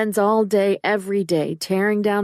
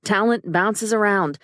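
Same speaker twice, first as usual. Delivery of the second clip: ordinary delivery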